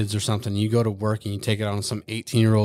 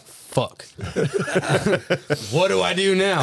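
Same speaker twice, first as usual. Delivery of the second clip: high, laughing panic; 8 semitones higher than usual. Retold or imitated. Recruiter rejected by a kid, laughing mock panic.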